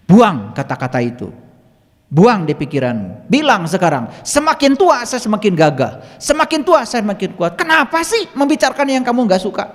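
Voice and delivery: forceful retold telling-off, climbing high; scolding. Healer ordering a patient to drop weak words, scolding. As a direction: drill the new words in, loud and exasperated. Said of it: "Saya omelin".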